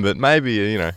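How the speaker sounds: normal talking voice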